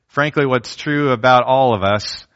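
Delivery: ordinary talk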